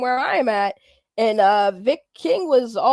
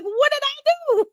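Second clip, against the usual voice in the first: way up high, mock disbelief; incredulous squeak; 12 semitones higher than usual. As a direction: squeak the question in mock shock. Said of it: "I'm like".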